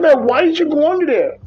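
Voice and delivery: high-pitched baffled question; incredulous. Retold or imitated. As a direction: ask it like you can't believe it. Incredulous driver questioning trucker.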